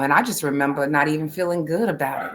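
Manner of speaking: ordinary talk, nothing marked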